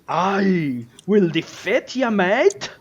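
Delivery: loud, high boast with stretched words; swooping, hammed-up battle challenge; heroic dwarf. Dwarf challenging the dragon from his carriage, bold drawn-out boast. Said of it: "And you see as he goes".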